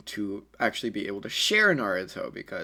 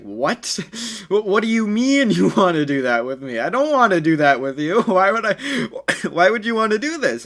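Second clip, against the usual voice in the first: higher, louder, baffled and laughing through it; cringing confusion; 7 semitones higher, 6 dB louder than usual. A narrator's reading. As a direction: recoil in flustered disbelief, laughing. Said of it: "he's cringing at this point".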